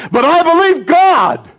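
high, loud burst of belief; cry of faith. Real, not acted. Pastor about his sick son, crying out high and loud.

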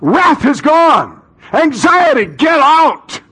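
high, loud, building preacher shout; fervent pulpit proclamation; worked-up preaching. Real, not acted. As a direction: preach it with rising fire. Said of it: "you're getting a little worked up".

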